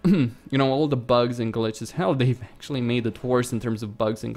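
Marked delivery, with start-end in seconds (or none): none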